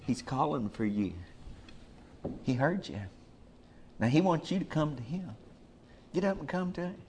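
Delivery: gentle urging. Acted. The crowd softly telling blind Bartimaeus to come. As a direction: coax him to get up.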